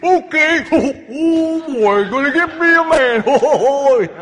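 very high, loud, drawn-out whale; hammed-up whale call; comic whale voice. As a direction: wail it slowly like an eager cartoon whale. Whale answers God in comic voice.

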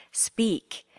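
ordinary talk

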